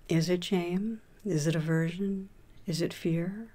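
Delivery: calm, even voice with long pauses between phrases; guided meditation. Acted. Teacher leading listeners through a meditation on shame, guided meditation voice. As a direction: guide them gently inward, leaving room to settle.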